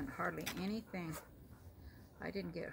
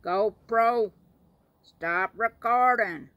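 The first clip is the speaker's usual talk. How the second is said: loud, barked command to the camera, the last stop recording slower; mock shouted order; 3 semitones higher, 16 dB louder, slower than usual. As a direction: shout it at the GoPro like a fed-up husband. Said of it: "He'd say".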